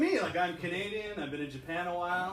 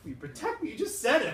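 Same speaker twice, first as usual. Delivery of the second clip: indignant disbelief; 10 semitones higher than usual. Acted. Indignant reply to grandpa.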